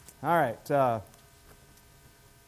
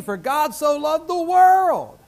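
preached emphasis; 8 semitones higher, 6 dB louder, slower than usual. Real, not acted. Quoting John three sixteen.

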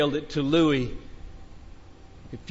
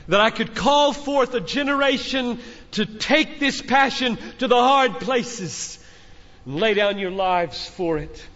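fervent call; 6 semitones higher than usual. Acted.

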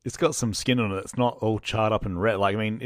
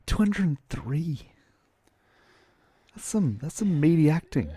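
whispering; 8 semitones higher than usual. Real, not acted. Awed aside about acting credits.

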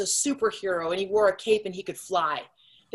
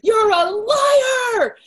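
much higher and louder, a slow tearful scream of denial; crying shout; 9 dB louder, much slower than usual. Retold or imitated. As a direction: hurl it at her through sobs. Child refusing news of father's crime.

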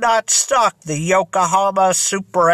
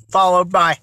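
ordinary delivery, nothing marked

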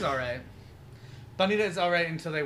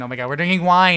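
excited; 2 semitones higher, 14 dB louder than usual. Real, not acted. Excited about the wine.

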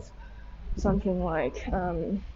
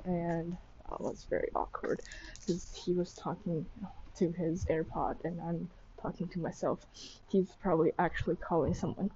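quiet; 2 semitones lower, 10 dB quieter than usual. Real, not acted. Passerby nearby, awkward.

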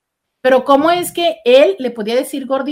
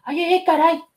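higher, mock exasperated ay ay ay; exasperated sigh; 6 semitones higher than usual. Mocking the listener who feels talked down to, exasperated. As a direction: play a fed-up listener.

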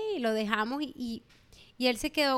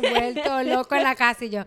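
usual speaking voice

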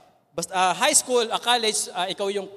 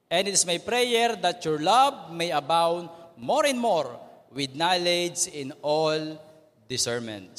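slow, measured recitation of a verse; group reading aloud; 3 semitones lower, slower than usual. Shown as a demonstration. Teacher leading the class through a Bible verse, slow and measured. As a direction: read the verse slowly with the class. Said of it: "basahin nga po natin ang sabay-sabay".

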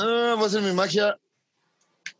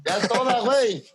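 laughing quote; 5 semitones higher than usual. Retold or imitated. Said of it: "como el güey de".

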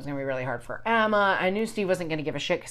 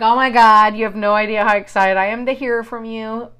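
louder acted-out gushing; retold excitement; 3 semitones higher, 9 dB louder than usual. Replying to a long-lost friend, retold excitement. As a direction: gush it, imitating your own delighted message.

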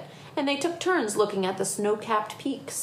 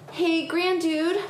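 put-on lightly panting boy; a hint of breathless child; out of breath; 8 semitones higher, slower than usual. A narrator's reading. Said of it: "panted Tom".

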